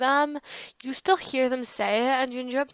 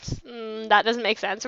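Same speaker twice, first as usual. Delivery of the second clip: normal talking voice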